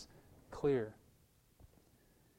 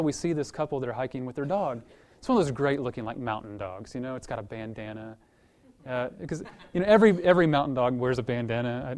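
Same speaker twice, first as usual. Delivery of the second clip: usual speaking voice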